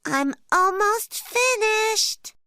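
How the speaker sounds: high, slow cub voice; little bear voice. Acted. Little Brown Bear beside dad doing a wee, high slow cub voice. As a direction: drag it out like a small cub taking his time. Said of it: "Little Brown Bear says".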